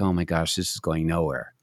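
bored aside. Retold or imitated. Bored inner reaction to small talk.

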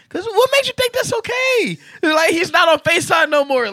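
mock her cheeky line in a squeaky voice; mocking daughter's sass. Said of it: "I'm like, "What?".

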